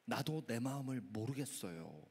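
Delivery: low, soft admission; sober reflection. Shown as a demonstration. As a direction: say it quietly, as your own confession. Preacher repeats it soberly himself.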